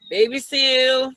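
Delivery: usual speaking voice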